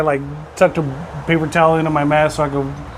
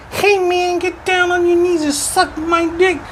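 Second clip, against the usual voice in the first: high, slow squeaky mock Tyson voice; Mike Tyson impression; slower than usual. Joke teller voicing Mike Tyson's squeaky demand. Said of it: "Mike Tyson said".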